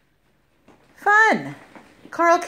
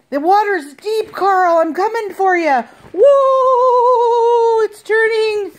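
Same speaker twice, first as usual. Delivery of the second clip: loud, playful whoa cries; on a bumpy road; 2 semitones higher, 4 dB louder than usual. Pretend. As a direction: ride the waves with him. Pretend ocean ride for toy.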